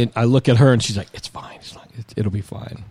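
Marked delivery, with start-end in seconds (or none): 1.1-2.8 put-on girlfriend murmurs to him so her father won't hear, hushed; hushed reassurance.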